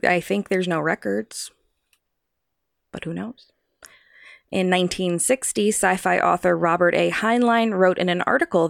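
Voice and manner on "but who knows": hushed spooky whisper. Pretend. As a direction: breathe it like a secret. Spooky aside.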